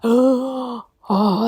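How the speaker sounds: imitating her own past panic at the offer; retold panic. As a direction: act out a flustered, frightened reaction. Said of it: "it's that fear again".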